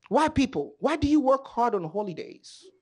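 ordinary delivery

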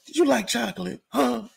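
high mock prankster asking strangers. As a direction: squeak it like the goofy prankster. Imitating prankster again.

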